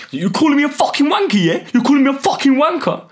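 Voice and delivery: loud, high-pitched outraged yelling; imitated angry driver. Storyteller imitating the furious driver at his window, angry yelling. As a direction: yell it as an enraged motorist. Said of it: "so angry".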